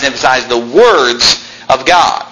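slow phrase with WORDS drawn out; deliberate weight on "words"; stress on WORDS. Shown as a demonstration. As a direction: hang on "words", then finish "of God". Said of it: "we need to emphasize what God emphasized".

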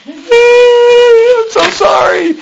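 high, slow, drawn-out wailing sob; weeping apology. Acted. Saul weeping to David, a high, slow wailing sob. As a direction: Saul breaking down in tears, crying out his apology. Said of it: "he's very emotional about this".

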